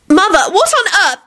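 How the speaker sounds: high-pitched startled exclamation; alarmed cry. Acted. Elizabeth running to her screaming mother, high and startled.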